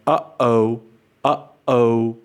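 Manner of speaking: "Uh-oh" with a clean catch between the halves; glottal stop. Shown as a demonstration. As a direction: break "Uh-oh" in the middle by closing the throat. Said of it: "this glottal stop in English".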